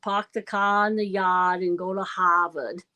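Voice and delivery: Boston accent, r's dropped, pahk the cah; Bostonian accent. Shown as a demonstration. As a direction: do your best Boston accent. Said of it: "that's my best Bostonian accent".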